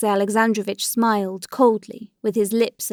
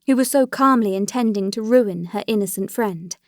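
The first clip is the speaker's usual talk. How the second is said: ordinary delivery, nothing marked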